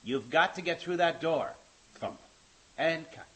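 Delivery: slow, loud director calling the shot; drawn-out mock film director; Martin Wood. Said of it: "Here's my imitation of Martin Wood directing".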